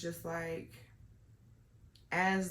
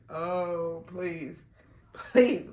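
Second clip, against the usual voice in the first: embarrassed protest; 10 dB louder, much slower than usual. Retold or imitated. Pleading, don't compliment me.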